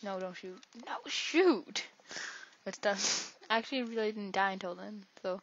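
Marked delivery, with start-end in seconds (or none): 0.9-1.8 higher, louder frustrated yelp; frustrated cry. Real, not acted. Gamer yelling as his fighter dies, frustrated yelp. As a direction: cry out as you lose.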